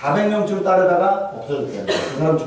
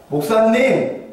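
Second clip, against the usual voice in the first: sharp call; 6 semitones higher, much slower than usual. Retold or imitated. Wife calling husband pastor.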